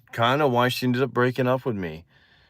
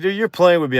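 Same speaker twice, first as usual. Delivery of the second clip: higher, louder retold protest; incredulous protest; 8 semitones higher, 5 dB louder, faster than usual. Boy thinking his friend is joking, incredulous. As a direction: push back in disbelief.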